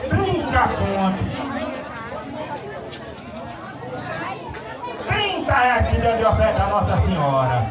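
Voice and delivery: slow drawn-out bid calls; auctioneer call. Real, not acted. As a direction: stretch each bid like an auctioneer. Auctioneer calling bids at festival.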